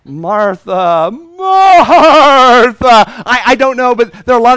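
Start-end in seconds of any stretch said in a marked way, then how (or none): 0.1-3.0 second Martha high, loud, with a laugh; exasperated laughing call. Shown as a demonstration. Preacher tries Jesus laughing at busy Martha, loud and high. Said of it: "Or was it like".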